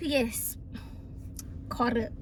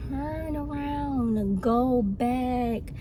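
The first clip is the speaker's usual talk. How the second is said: very slow, drawn-out words; slow spelling-out; 4 dB quieter, much slower than usual. Retold or imitated. Passenger instructing driver with little English, exaggeratedly slow. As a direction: stretch every word as if for a slow listener.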